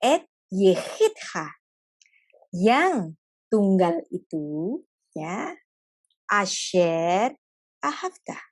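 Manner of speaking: very slow, drawn-out tender words; God gently naming the beloved son; slow and gentle. Acted. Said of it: "ngomongnya itu pelan-pelan gitu".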